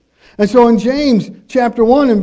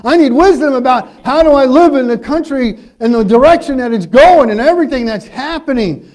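louder, higher earnest plea to God; pleading prayer; 4 semitones higher, 5 dB louder than usual. Acted. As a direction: cry out to God for wisdom.

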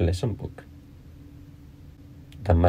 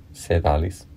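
ordinary delivery

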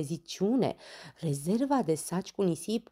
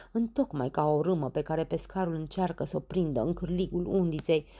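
ordinary delivery, nothing marked

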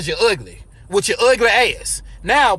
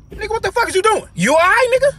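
incredulous; 8 semitones higher, faster than usual. Acted. Reacting to friend refusing bomb.

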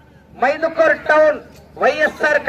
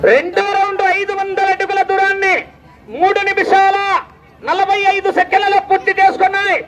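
high, level-pitched announcing call; race announcer; 4 semitones higher than usual. Real, not acted. Announcer calling bullock race round result to crowd, high chanting call. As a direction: ring out the round result on one high note.

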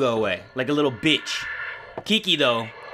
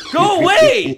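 panicked shout; 13 semitones higher, 8 dB louder, slower than usual. Real, not acted. Shouting at monster.

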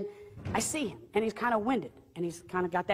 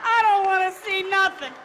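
high, loud comic pleading; desperate prayer; 8 semitones higher, 4 dB louder than usual. Retold or imitated. Praying not to see an apparition on the mountain, desperate pleading. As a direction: plead to heaven in mock panic, slowly and insistently. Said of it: "So I begin to pray this prayer".

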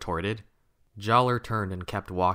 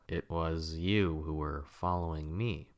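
softer, cold and suspicious; icy suspicious voice; 2 semitones lower, 5 dB quieter than usual. A narrator's reading. Kopaka coolly accuses the boys, icy and suspicious. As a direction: let the chill and distrust show. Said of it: "his icy voice full of suspicion".

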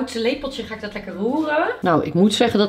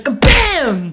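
loud cheer of victory; triumphant exclamation; 4 semitones higher, 7 dB louder than usual. Real, not acted. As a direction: punch it like a win. Celebrating a nine.

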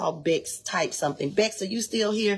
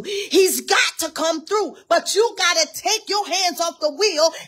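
higher and louder, rising fervent preacher; preaching at full force; 7 semitones higher, 4 dB louder than usual. Acted. Preacher exhorts the congregation to trust God, fervent and loud. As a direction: build the sermon to a fiery climax.

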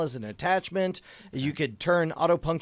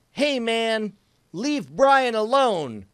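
higher, slow hammed-up call; mild mock shout; 8 semitones higher, 4 dB louder, much slower than usual. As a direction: call out to defend Brian, half in jest. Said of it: "shouted Andrew".

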